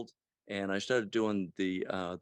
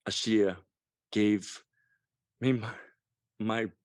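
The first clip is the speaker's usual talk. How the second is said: choked up; slower than usual. Real, not acted. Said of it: "I'll try not to get choked up here".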